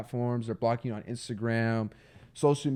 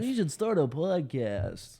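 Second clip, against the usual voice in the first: mock basic viewer; slower than usual. Mimicking the basic viewer joke.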